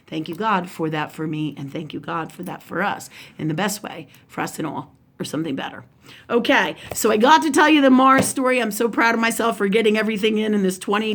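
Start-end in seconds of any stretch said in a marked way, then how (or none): 0.1-5.8 soft, low prayer voice; hushed, lowered closing prayer. Real, not acted. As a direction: give thanks quietly, letting the voice drop. Says a prayer.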